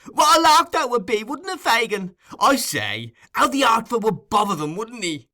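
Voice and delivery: delighted glee. Acted. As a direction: crow about it with delight. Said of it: "laughed Master Bates".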